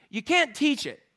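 usual speaking voice

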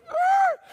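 very high loud squeal; horse skid screech. Acted. Horse braking at the cliff, loud high squeal.

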